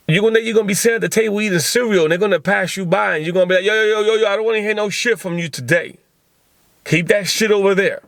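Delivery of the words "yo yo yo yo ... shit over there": higher-pitched mock warding-off; acted defensive irritation. Podcaster acts out warding off a roommate at breakfast, irritated.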